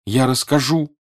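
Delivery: understated tearful exclamation; tearful cry. A narrator's reading. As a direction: cry it out through tears. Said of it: "с прорвавшимися слезами вскрикнула Соня".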